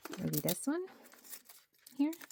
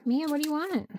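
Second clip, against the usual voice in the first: high, louder call to the cat; 10 semitones higher, 8 dB louder than usual. Real, not acted. Cat climbs on desk.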